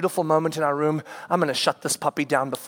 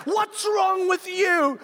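slow, much higher exasperated yell; exasperated shout; 13 semitones higher, much slower than usual. Retold or imitated. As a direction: demand it over the crying. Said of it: "I'm shouting at them".

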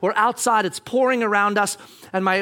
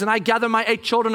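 usual speaking voice

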